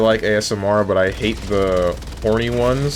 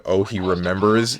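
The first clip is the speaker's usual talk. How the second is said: ordinary delivery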